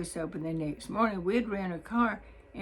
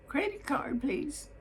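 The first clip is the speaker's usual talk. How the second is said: Credit card said much higher and falling, prim; repeated office request; 7 semitones higher than usual. Retold or imitated. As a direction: insist politely, pitched up. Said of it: "in her fine office voice".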